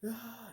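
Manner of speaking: mock yell from the flames; imitated yell. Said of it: "I'm yelling and yelling".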